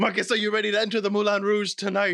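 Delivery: quick French cabaret host; French accent. Acted. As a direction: usher the guest in with a hammed-up French accent. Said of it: "I hate this accent so much".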